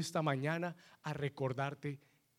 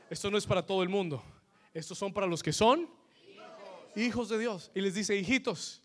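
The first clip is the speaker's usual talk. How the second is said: louder, higher, quick preaching push; rising preaching; 6 semitones higher, 6 dB louder than usual. Acted. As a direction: drive the point home with rising energy. Preacher building up the sermon.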